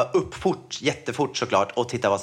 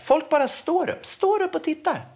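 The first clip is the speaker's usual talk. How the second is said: outraged disbelief. Real, not acted. Indignant retelling of onlookers.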